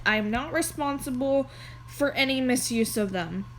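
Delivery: normal talking voice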